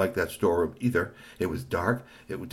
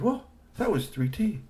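excited robot voice; 13 semitones higher than usual. A narrator's reading.

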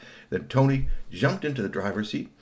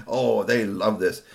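ordinary delivery

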